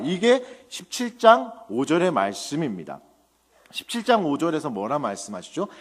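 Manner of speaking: normal talking voice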